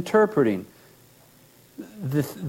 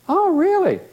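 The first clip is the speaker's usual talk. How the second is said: much higher, hammed-up astonishment; mock surprise; 12 semitones higher than usual. Therapist teasing the client, hammed-up surprise. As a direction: feign being amazed.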